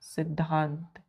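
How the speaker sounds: सिद्धांत said softly and slowly; soft drawn answer. Shown as a demonstration. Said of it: "क्या होता है?". (सिद्धांत धीरे और नरम आवाज़ में)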